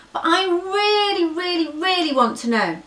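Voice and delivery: high tearful begging; whiny pleading. Acted. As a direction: whimper it, desperate. Baby elephant begs bird.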